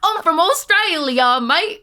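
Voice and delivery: hammed-up Australian, high, loud and slow; Australian accent. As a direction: stretch out a mock Australian accent. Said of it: "do your Australian accent next".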